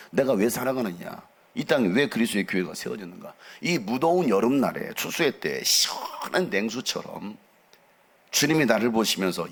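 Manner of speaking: ordinary delivery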